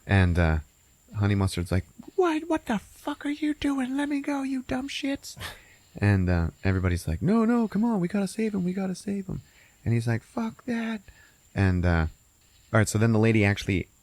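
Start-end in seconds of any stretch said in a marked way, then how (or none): 2.1-5.4 high, squeezed character voice; acted outrage. Honey Mustard's outraged, panicked quote in retelling. 7.2-9.4 mock pleading. Group pleading in retold scene. 10.2-11.0 high, soft character voice; mock defiance. Retold defiant refusal, high and soft.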